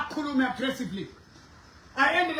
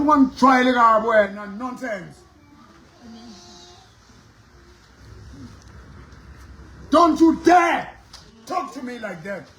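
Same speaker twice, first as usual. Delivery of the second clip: heated family shouting; 5 dB louder than usual. Retold or imitated. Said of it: "rebuking a man".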